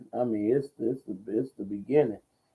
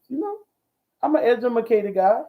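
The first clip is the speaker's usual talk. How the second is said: indignant protest; 11 semitones higher than usual. Retold or imitated. Acting out his boast to God.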